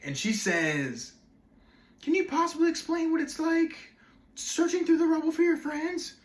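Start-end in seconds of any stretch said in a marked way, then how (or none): 2.0-6.2 mock-meaningful interviewer, very high monotone; high-pitched, overly meaningful TV questioning; Katie Couric. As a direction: parody an earnest TV interviewer in a high, level voice. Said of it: "being, like, all meaningful".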